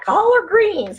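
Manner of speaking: high, loud try at a Southern collard greens; failed Southern accent imitation; attempted Southern accent. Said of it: "I can't say it like the Southern".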